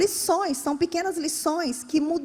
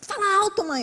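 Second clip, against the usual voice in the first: high-pitched retold call to mom; mock raised voice; 9 semitones higher than usual. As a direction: imitate a child demanding, pitched high. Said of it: "aumenta a voz".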